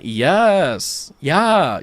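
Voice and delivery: light Californian drawl on the vowels. Shown as a demonstration. Showing how the girl talked, Californian drawl. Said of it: "the Californian drawl".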